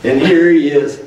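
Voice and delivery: usual speaking voice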